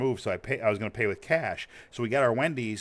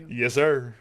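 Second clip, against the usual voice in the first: laughing; 3 semitones higher, 4 dB louder than usual. Retold or imitated. Repeats cop answer, laughing.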